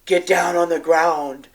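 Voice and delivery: loud, forceful order; dynamic command. Retold or imitated. Teacher ordering students down as gunfire nears, dynamic commanding voice. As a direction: project it as an urgent command to a room. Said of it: "I said in this very dynamic voice".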